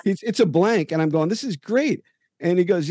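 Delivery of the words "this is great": much higher, delighted; excited. Retold or imitated. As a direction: let the delight lift the voice high. Reacting to the blank jersey.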